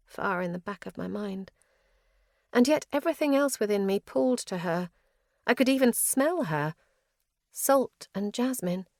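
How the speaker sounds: plain reading voice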